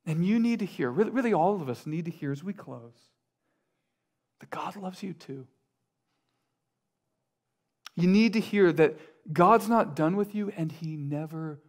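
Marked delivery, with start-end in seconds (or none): 4.4-5.5 hushed, nearly whispered; tender reassurance. Real, not acted. Preacher reassuring struggling listeners of God's love, tenderly hushed. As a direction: drop right down to a soft whisper.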